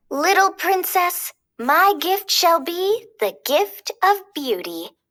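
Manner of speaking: high, slow, tender fairy voice; fairy blessing a baby. Acted. Flora blesses the baby princess, high and slow. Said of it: "she waved her wand and said".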